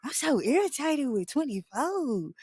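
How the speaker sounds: high, whiny mock of an exasperated girlfriend; mimicking Selma's irritated brush-off. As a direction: play her all put-upon and irritated. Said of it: "And Selma like".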